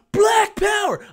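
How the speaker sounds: high-pitched mock yell; imitated yell. Man right behind me in the theater, imitated high yell. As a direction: belt it out like a rowdy moviegoer. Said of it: "just yelled out".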